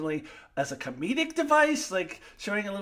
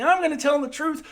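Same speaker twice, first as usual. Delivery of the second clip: high, loud, quick mocking repeat; mocking Mariner; 11 semitones higher, 8 dB louder, faster than usual. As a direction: parrot her vindictive line, fast and high. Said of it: "she kept saying".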